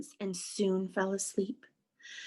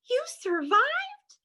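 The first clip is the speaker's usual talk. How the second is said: high, slow incredulous question; astonished cry; slower than usual. Acted. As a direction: draw it out high in amazement. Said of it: "cried Stella Luna".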